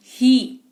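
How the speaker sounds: ही with a long ee, then stopped; long ee demo. Shown as a demonstration. Teacher repeating ही to show the long ee. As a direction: stretch the ee in ही and stop. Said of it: "क्या कहा?".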